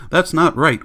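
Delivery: high, loud wail of protest. A narrator's reading. Missus Bowles protesting the poem reading, wailing. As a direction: cry it out in distress. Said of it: "wailed Missus Bowles".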